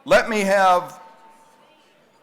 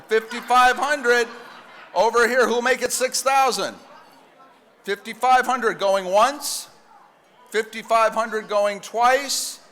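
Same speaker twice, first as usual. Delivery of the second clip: drawn-out going once, going twice; slow the final calls; slow auctioneer call; 4 semitones higher, slower than usual. Acted. Closing the bidding.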